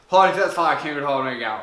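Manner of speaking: low, slow, garbled English; unfriendly American clerk. Acted. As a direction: grumble mock English like a clerk who won't bother. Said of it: "aimable qu'il se faisait même pas chier à essayer de parler". (employé américain pas aimable)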